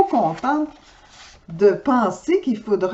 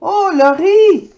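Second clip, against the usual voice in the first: moved relief; 8 semitones higher, 6 dB louder, slower than usual. Acted.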